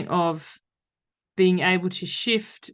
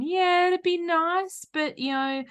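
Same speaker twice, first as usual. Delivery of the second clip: much higher, slow, dismissive drawl; lazy procrastinator; 11 semitones higher, slower than usual. Retold or imitated. Voicing procrastination thoughts, high and lazy. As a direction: brush it off for later. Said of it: "below the line thinking, procrastination".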